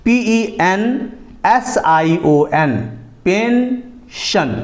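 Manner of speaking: letters then pension drawn out, very slow; slow spelling demo. Shown as a demonstration. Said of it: "जैसे". (धीरे-धीरे spelling और उच्चारण)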